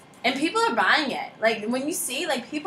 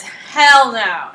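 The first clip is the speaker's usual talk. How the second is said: a blunt, louder "Hell no" from mom; mom's flat refusal; 11 dB louder than usual. Retold or imitated. Retelling mom's answer to the Disney request, blunt and loud.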